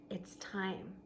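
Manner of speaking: a soft, breathless whisper of resolve; whispered to himself. Acted. Gorilla at the door telling himself to go, whispered. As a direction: whisper it to yourself, gathering courage.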